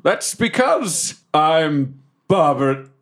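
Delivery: slow theatrical character voice; hammed-up big reveal; grand identity reveal. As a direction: declare the name with dramatic spacing. Reveals his true identity grandly.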